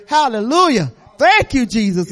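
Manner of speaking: loud rising church praise; joyful praise. Real, not acted. Church member testifying to the congregation, loud joyful praise. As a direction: shout praise to the Lord.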